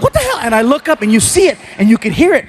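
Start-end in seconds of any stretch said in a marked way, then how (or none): 0.0-0.4 high startled yelp; shocked. Retold or imitated. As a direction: cry out in shock. Startled by bat hit.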